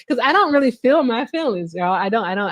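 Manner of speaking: ordinary talk, nothing marked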